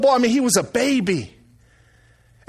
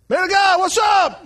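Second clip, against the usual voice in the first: hearty shouted greeting; 9 semitones higher than usual. Retold or imitated. Pastor friend phones the preacher, hearty retold greeting. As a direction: call out big like an old buddy on the phone.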